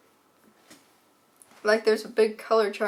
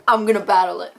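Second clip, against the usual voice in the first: loud determined; 8 dB louder, faster than usual. Retold or imitated. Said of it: "I'm like".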